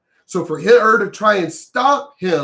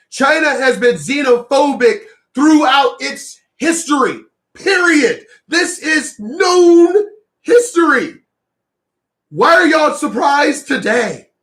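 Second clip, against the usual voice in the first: exasperated yell; 6 semitones higher, 3 dB louder than usual. Real, not acted. Ranting at viewers who act surprised, exasperated yell. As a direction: voice shoots up high and loud, almost cracking.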